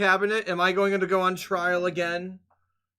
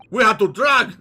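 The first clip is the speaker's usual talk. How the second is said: loud bad Russian heavy voice; Heavy Weapons Guy; 3 semitones higher, 6 dB louder than usual. Player tries a voice for the sailor, booming Russian. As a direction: boom it out like a big Russian brute. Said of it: "give him heavy weapons guy voice".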